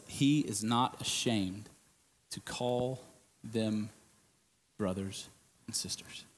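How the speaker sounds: slow, soft reading that fades to a whisper; hushed scripture reading. Acted. Preacher reading the verse to the congregation, hushed.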